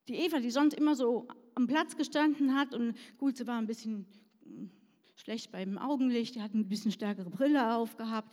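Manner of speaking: ordinary talk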